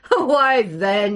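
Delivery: a booming, good-humoured jest; joking. Acted. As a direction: laugh off the taunt, loud and easy. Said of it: "he joked".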